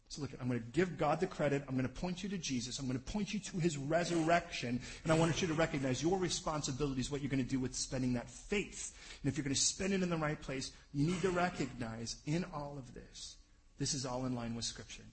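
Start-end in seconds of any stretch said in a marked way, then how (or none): none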